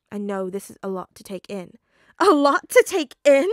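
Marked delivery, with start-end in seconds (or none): 2.2-3.5 much louder, higher echo in disbelief; incredulous shout. Acted. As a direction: throw the words back in outrage. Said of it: "Peter repeated".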